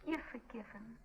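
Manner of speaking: soft, intimate murmur; hushed, coaxing forgiveness; tender making up. Acted. Lovers kiss and make up.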